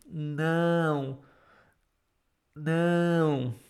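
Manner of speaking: usual speaking voice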